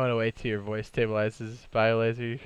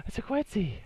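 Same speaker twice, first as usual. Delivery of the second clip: surprised gasp; 12 semitones higher than usual. Real, not acted. Gasps at a creature.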